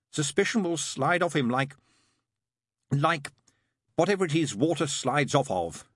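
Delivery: hesitant search for a simile; falter, then finish weakly; lame finish. A narrator's reading. Demon running out of argument, mildly lame finish. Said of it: "he finished lamely".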